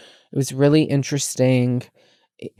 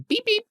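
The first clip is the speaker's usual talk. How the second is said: imitated car beep; 4 dB quieter than usual.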